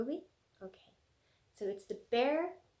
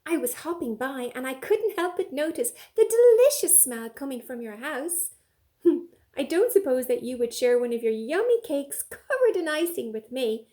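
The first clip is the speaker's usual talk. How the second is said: higher, louder little rabbit voice; rabbit asking; 3 semitones higher, 9 dB louder than usual. Acted. Rabbit begging bear for cake, higher. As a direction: ask eagerly as the rabbit. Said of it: "said Rabbit".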